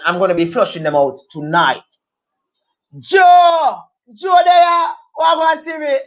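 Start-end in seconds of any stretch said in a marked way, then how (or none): 3.0-6.0 high, loud hail to a friend who just turned up; calling out a greeting. Real, not acted. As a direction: holler the hello with drawn-out names. Calls out greeting to a viewer.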